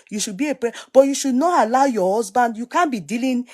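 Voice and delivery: ordinary talk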